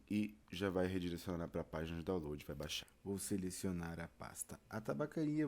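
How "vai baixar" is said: normal talking voice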